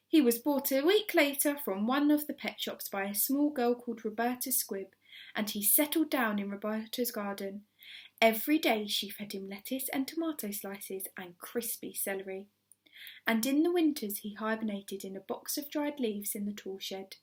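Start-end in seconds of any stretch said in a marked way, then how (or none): none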